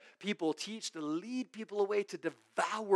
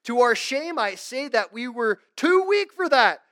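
louder, higher biting sarcasm; sarcastic Paul; 7 semitones higher, 11 dB louder than usual. Retold or imitated. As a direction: read it with a sneer. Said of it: "He says sarcastically".

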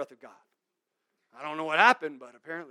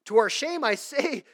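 louder, higher biting sarcasm; sarcastic Paul; 7 semitones higher, 8 dB louder than usual. Retold or imitated. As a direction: read it with a sneer. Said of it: "he's very sarcastic".

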